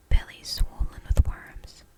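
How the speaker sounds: ordinary delivery, nothing marked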